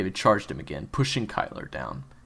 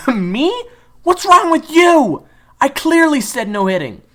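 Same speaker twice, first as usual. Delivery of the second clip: indignant retort; 15 dB louder than usual. Acted. June hurling Kyler's words back, high and indignant. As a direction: throw his words back at him.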